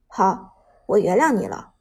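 understated, going through the motions; flat, indifferent. A narrator's reading. Su Zhe accepting a forced apology, flatly indifferent. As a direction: forgive as a mere formality. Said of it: "苏哲表情平淡地说道".

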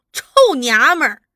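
loud, abusive outburst; angry curse. A narrator's reading. Interrogator swearing at widowed sister, angry curse. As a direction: hurl the insult at her. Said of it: "吴启超骂道".

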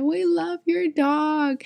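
put-on very high, slow child voice; cute kid voice. Little kids telling her they love her dog, tiny high voice. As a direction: say it like an adoring toddler. Voicing the kids about Bob.

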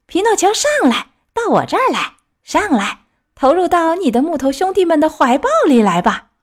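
high, eager summons; calling out. A narrator's reading. Clown invites Pinocchio up on stage, high eager calling. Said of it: "花衣小丑叫道".

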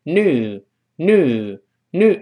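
new said three times without the y sound; slow American new with a plain u. Shown as a demonstration. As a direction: say new as "noo", slowly. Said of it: "所以读成new".

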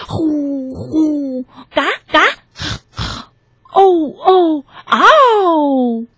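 high hoots, squawks and a howl; imitated monster noises. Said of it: "因为他叫起来".

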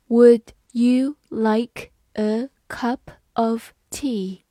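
word by word, with gaps between words; slow reading. Shown as a demonstration. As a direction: read it out slowly for learners. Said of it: "好，慢慢来读". (慢慢逐词朗读)

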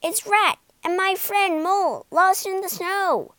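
high, carrying call for shelter; urgent yelling. A narrator's reading. Rat, lost in the snow, calls to Badger, yelling. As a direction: call out loudly to be let in. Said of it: "yelled Rat".

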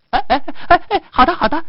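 very high little rabbit voice; compliant rabbit. Acted. Rabbit agrees to run round tree, compliant rabbit voice. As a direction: squeak out eager agreement up high. Rabbit eagerly obeys the order.

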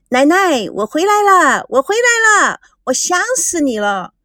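rising, eager cries of reunion; girl rushing into grandma's arms, excited and high. A narrator's reading.

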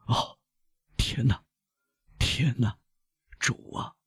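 faint, halting moans of distress; weak, breathy anguish; stricken gasping. A narrator's reading. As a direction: gasp out a soft prayer between pauses. Old servant seized by a fit.

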